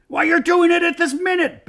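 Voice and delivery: loud, high-pitched accusing bellow. A narrator's reading. Trumpkin catching the bear at it, bellowing. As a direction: shout it out in outrage. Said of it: "bellowed Trumpkin".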